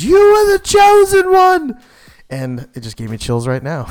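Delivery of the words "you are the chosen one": high, loud desperate shout; anguished movie-quote yell; imitated yell. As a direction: belt it out like Obi-Wan's cry of betrayal. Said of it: "Obi-Wan Kenobi yells".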